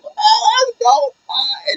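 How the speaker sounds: very high excited outburst; thrilled squeal. Retold or imitated. Fan reliving the manager's refusal, high excited squeal.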